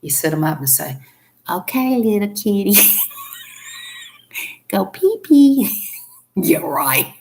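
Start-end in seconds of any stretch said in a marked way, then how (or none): 1.5-5.8 coaxing the kitty. Pretend. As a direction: sweet-talk a cat into peeing. Coaxing cat onto toilet.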